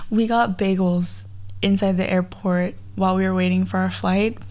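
normal talking voice

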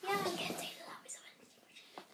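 quick hushed whisper. Real, not acted. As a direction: whisper it fast under your breath. Whispered aside during game. (geflüstert)

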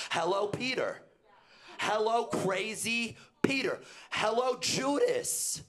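high, loud, slow greeting to each disciple; mock greeting Peter, crazy Peter, then Judas; calling out names. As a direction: hail each name across the room.

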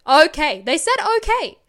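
loud, high outraged disbelief; indignant reveal. Real, not acted. Telling the host her old friends only said okay, loud indignant disbelief.

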